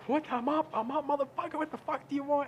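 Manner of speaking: high, strained, irritated curse-out; high-pitched furious grumble; groggy angry answer. Retold or imitated. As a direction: snap at the caller, half awake. Retells angrily answering the phone.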